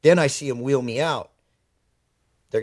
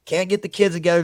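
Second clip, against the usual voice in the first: ordinary talk, nothing marked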